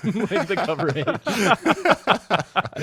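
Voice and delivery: giggling, high and loud; laughing. Real, not acted. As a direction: laugh through the words. Laughing through lines.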